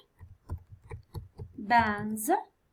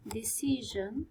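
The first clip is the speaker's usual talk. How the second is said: slow whisper; 9 dB quieter, slower than usual. Real, not acted. Teacher mouthing the word while writing, slow whisper. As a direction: whisper decision slowly to herself. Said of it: "teacher whispers word to herself".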